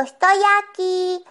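retold call to the searchers. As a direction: sing out from a hiding place. Child calls out from hiding place.

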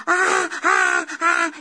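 loud startled "ah ah ah" of fright; frightened cry. Retold or imitated. Boy sees the skeleton X-ray and cries out in fright. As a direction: cry out in alarm at the picture.

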